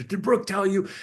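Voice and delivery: high, mildly heated accusation; retold angry outburst. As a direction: imitate a son snapping at his sister, lightly. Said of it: "he went off the handle".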